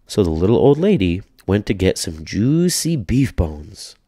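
plain reading voice

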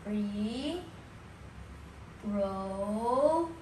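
RE... PRO... DUCE, drawn out with long pauses between the syllables. Shown as a demonstration. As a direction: say "reproduce" very slowly in three separate parts, rising on each. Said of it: "This is how you say it".

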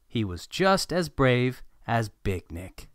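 usual speaking voice

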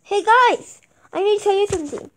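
usual speaking voice

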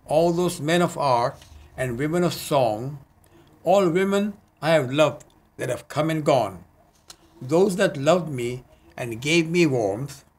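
measured, lyrical poetry reading; poem recital. Acted. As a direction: savor each line like a love poem. Reads own poem aloud.

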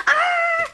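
short high play scream; pretend scream. Toy figure seeing a spaceship crash, pretend scream. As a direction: yelp like something is crashing at you.

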